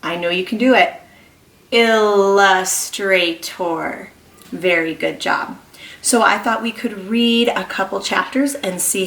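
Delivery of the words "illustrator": slow emphatic "Illustrator" for air-writing; drawn-out illustrator. Shown as a demonstration. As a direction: spell it out loud with the class. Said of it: "get those fingers in the air".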